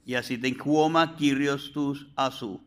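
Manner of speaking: normal talking voice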